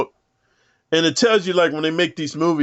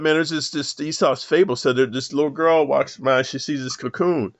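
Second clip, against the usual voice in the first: ordinary talk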